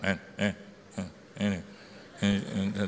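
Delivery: low, halting hints, imitated; unclear coded talk. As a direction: fumble vaguely so others won't understand. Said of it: "bahasa kita suka nggak jelas".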